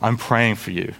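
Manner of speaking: low, soft token sympathy; empty platitude. Acted. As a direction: say it as a hollow courtesy. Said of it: "It's not just an empty".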